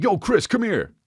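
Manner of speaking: high mock call across the market. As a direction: shout it like a fishmonger hailing you. Fish guys calling out.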